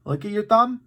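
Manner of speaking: usual speaking voice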